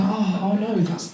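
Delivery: hushed polite concern, acted; soft sympathy. Listeners reacting to uncle's sad news, soft mock sympathy. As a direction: murmur a sympathetic reaction softly. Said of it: "everyone goes".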